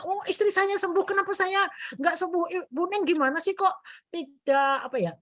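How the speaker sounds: high-pitched complaining patient, imitated; mock a patient protesting that he isn't cured; imitating an angry patient. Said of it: "dia ini karena marah". (menirukan pasien yang marah dengan suara tinggi)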